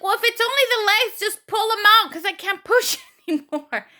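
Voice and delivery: high, loud exasperated wail; mock tearful. Exhausted patient begging for the baby to be pulled out, mock tearful. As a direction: protest as if nearly in tears. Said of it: "said that pretty much in tears".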